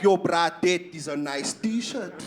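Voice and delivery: slow, low mock-menacing compliment. Comic doing a compliment that sounds like a robbery, slow and low. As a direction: make a compliment sound like a mugging. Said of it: "I could just be like".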